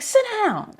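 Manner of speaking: high-pitched mock command; retold exasperated command; scolding order. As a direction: order it high, without shouting.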